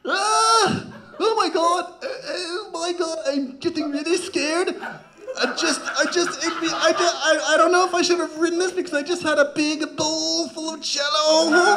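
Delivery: high, squeaky panicked celebrity voice; a lightly done famous comic freaking out; Bill Cosby. Acted. Bill Cosby terrified on a roller coaster, in a high panicked voice. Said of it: "Bill Cosby".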